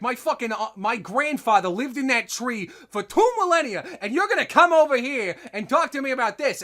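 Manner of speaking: high, indignant New York rant; hammed-up outraged New Yorker accent. New York elf defending grandfather's tree, indignant accent. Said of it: "talk like they're from New York".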